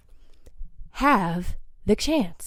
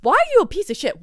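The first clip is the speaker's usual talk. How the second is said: exasperated, higher-pitched demand; real anger in a raised-pitch question; mad; 6 semitones higher, faster than usual. Real, not acted. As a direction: demand an answer, fed up. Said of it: "like, mad".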